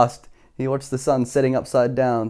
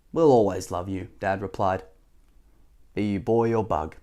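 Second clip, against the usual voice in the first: tender reassurance; 6 semitones lower than usual. A narrator's reading. Parents tell their son they still love him, tender and low.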